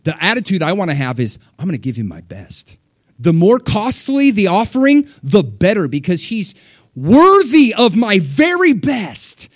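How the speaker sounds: rising, fervent pledge of devotion; passionate worshipper. Retold or imitated. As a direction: declare it like a devoted giver. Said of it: "He's like".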